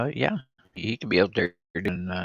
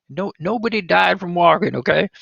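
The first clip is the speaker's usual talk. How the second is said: high insistent; 9 semitones higher, 5 dB louder than usual. Real, not acted. Insisting, raised voice.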